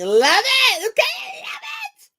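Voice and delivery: shrill, very high squeal of delight; excited squeal. Real, not acted. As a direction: squeal it like a fangirl. Squealing over a ship.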